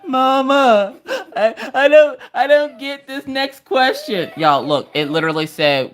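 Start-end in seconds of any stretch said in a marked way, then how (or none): 0.1-4.3 whining, near-tearful kid imitation; Maggie. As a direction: drag it out high like a sobbing child. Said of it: "Maggie's like".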